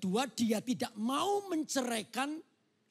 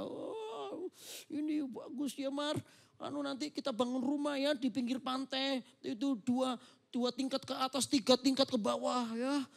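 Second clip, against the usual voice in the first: higher, eager fiancé planning a wedding; excited groom; 4 semitones higher than usual. Acted. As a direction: gush about the new house. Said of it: "aku ini ngomong cerita excited ini".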